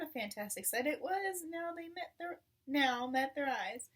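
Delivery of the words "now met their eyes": ordinary delivery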